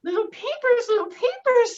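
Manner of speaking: high, loud, thrilled hen voice; high excited hen calling her chicks; excited mother hen. Acted. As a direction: gush like a delighted mother hen.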